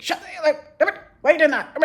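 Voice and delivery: high, sharp mock parental yell. A harsh parent yelling at the kids, a high mock yell. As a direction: bark it at the kids like a fed-up parent.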